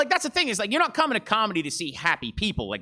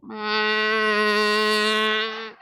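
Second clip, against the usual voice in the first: a far-off moo that whooshes by; passing cow moo. Pretend. Comedian doing a cow speeding past, a whooshing moo. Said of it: "a cow driving past you quickly".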